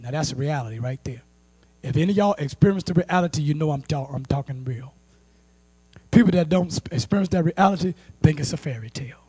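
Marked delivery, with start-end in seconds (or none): none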